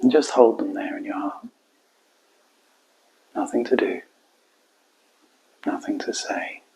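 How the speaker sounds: quiet, spacious meditation voice; guided meditation. Acted. Coach guiding listeners to hold client and heart, quiet meditation. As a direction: let each phrase settle into a long silence.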